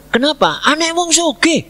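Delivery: high, urgent "Anak wong sugih" with the stress on "wong"; alarmed outburst. Retold or imitated. Fear of a rich family suing. ("Anak wong sugih" diucapkan tinggi, kaget dan panik)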